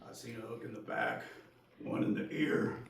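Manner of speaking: put-on low, soft old dockside man. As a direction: drop to a quiet, halting old-timer's voice with long pauses. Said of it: "Old man on the dock".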